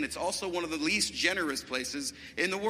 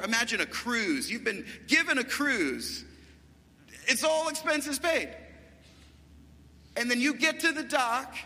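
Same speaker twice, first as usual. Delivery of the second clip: higher-pitched, excited sermon illustration; animated preaching; 6 semitones higher than usual. Real, not acted. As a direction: build the picture for the congregation with urgency.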